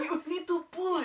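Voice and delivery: high mock coach, laughing through it. Shown as a demonstration. Co-host echoing the mock coaching, high coach voice. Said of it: "We're coach voicing?".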